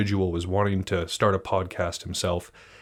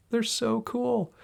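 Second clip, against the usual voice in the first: high, slow gushing; adoring gush; much slower than usual. Real, not acted. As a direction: swoon over them, stretched out. Gushing over his robots.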